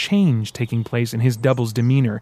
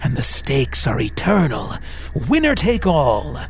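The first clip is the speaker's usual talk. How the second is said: sinister devil; 2 semitones higher than usual. Acted.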